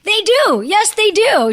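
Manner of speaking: excited fan answer; eager yes. Retold or imitated. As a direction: gush to a celebrity. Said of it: "I'm like".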